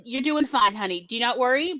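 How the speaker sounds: ordinary talk, nothing marked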